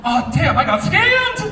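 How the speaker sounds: loud, high exclamation of sudden realization; mock dismay. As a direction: play up the shock of being conned. Acting out realizing he was scammed.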